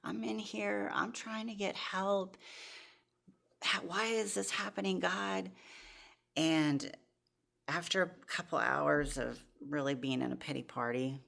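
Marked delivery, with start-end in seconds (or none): 0.0-5.5 angry at God. Retold or imitated. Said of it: "I was so mad at God".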